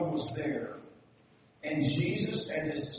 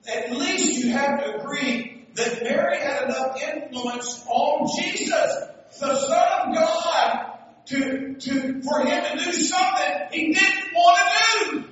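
high, loud preaching, building to a peak; full-force preaching; 10 semitones higher, 9 dB louder than usual. Acted. Preacher urging agreement on Mary's influence, building forcefully. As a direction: stretch it out and climb to a shout.

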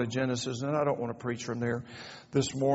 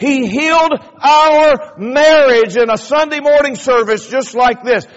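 louder, higher, each gift hammered home; preaching at full force; 8 semitones higher, 12 dB louder than usual. Acted. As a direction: proclaim it to the congregation with fire.

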